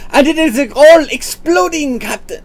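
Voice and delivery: high, loud, lightly Scottish engineer shout; Scottish accent. Acted. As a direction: yell the Scottish engineer's warning to the captain. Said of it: "I lost my Scottish accent".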